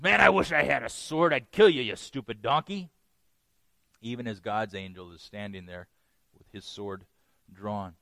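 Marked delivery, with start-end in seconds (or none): 0.0-2.9 mock irritated outburst; angry. Balaam threatening his donkey, mildly angry. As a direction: grumble threats at a stubborn animal, with loud bursts. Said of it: "in his anger he says".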